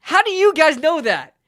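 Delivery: high, loud astonishment; incredulous. Real, not acted. Streamer asking Eagles fans how they know, incredulous. Amazed by rival fans' knowledge.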